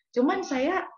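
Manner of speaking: CUMAN said high and rising; rising emphasis. Real, not acted. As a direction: lift "cuman" sharply. Emphatic sermon point. ("cuman" diucapkan tinggi dan naik)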